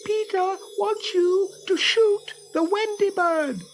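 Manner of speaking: very high, slow tiny fairy; sweet sly fairy lie; shrill fairy voice. Acted. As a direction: Tinker Bell tricking the boys, very high. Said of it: "shrill voice of Tinker Bell".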